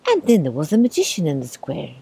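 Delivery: usual speaking voice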